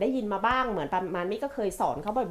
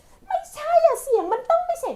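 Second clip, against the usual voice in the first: much higher, slow mock-cross child voice; hammed-up huffy child correcting mum; imitating angry daughter; 4 dB louder than usual. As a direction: play the sulky kid who insists. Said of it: "เขาโกรธเราอะ เจสสิก้าโกรธ".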